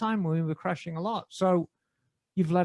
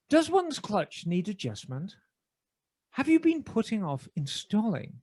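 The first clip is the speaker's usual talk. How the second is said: measured BBC announcer reading an ad. Acted. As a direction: deliver the sponsor read in your best BBC British. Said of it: "Can I do it in my best B B C voice".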